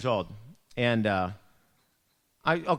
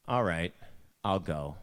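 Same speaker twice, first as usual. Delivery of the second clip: grudging agreement; 6 semitones lower, slower than usual. Retold or imitated. Teen grudgingly agrees to mom.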